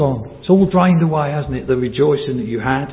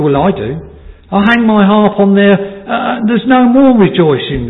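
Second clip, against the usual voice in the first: higher, insistent pulpit voice; impassioned preaching; 7 semitones higher, 5 dB louder than usual. Acted. Preacher confesses hanging up his own harp, impassioned preaching. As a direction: preach with raised pitch and force.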